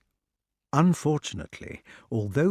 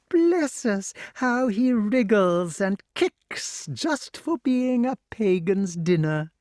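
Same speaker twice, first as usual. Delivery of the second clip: very high, swooping witch voice; gloating witch; 10 semitones higher, slower than usual. A narrator's reading. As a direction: exclaim with drawn-out delight at your prey. Voices Madam Mim.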